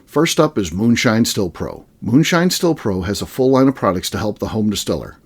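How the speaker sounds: low, measured podcast ad read; sponsor ad read. Real, not acted. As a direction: read the sponsor copy smoothly. Host reads sponsor message.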